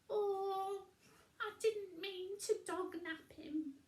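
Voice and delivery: high, loud fretting witch voice; high worried witch; Winnie voice. A narrator's reading.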